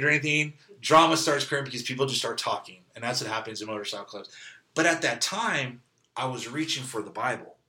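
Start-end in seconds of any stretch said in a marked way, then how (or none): none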